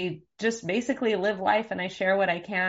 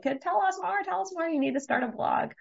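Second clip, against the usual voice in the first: high, pleading urging at usual volume; eager friends and family; 11 semitones higher than usual. Retold or imitated. Friends urging her to share, eager and high. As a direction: voice an excited crowd of friends, pitched well up.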